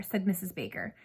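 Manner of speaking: usual speaking voice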